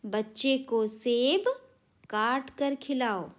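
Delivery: slow and clear, each word spaced; teacher dictating the right sentence slowly; slow dictation. Shown as a demonstration. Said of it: "लिखेंगे शुद्ध करते हुए".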